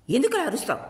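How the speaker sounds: high, loud retort at her mother; shouting back. A narrator's reading. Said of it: "Sabari కూడా అరిచింది". (తల్లిపై ఎదురు అరుపు, ఎత్తైన స్వరం)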